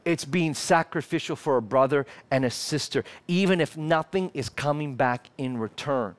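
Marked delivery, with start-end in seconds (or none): none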